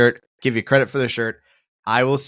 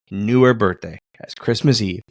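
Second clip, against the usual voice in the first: ordinary talk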